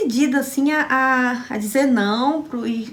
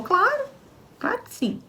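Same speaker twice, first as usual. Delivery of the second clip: mock catechist; 13 semitones higher, 3 dB quieter than usual. Catechist answers emphatically.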